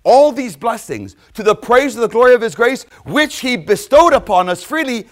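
loud, high, ringing preacher cry; full-voiced sermon climax; preaching at full force. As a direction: proclaim it to the whole church.

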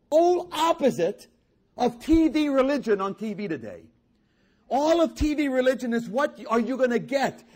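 high, loud, impassioned pulpit delivery; full-force preaching. Real, not acted. Preacher urging the congregation off selfish TV religion, full-force preaching. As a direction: drive it home, stretching the key words.

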